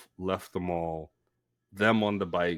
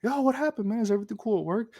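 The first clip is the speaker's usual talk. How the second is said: much higher, casual yo voice; friends calling out; 13 semitones higher, much faster than usual. Retold or imitated. Friends asking what happened at work, much higher and casual. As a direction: play the buddies checking in. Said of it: "They're like".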